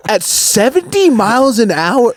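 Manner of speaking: can't believe what he's hearing; incredulous. Retold or imitated. To his brother in the hospital, shocked and incredulous.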